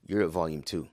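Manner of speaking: ordinary talk, nothing marked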